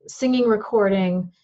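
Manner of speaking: ordinary talk, nothing marked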